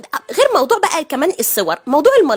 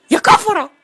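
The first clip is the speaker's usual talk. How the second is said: hammed-up scandalized cry, louder and higher; mock outrage; 5 semitones higher, 3 dB louder than usual. Comic scandal at a shrimp fountain, mock outraged cry. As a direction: play it like a shocked auntie.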